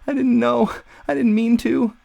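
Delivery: high-pitched, frightened pleading confession; small, high voice owning up. Acted. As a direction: blurt it out like a panicked child. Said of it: "He hears himself confess again".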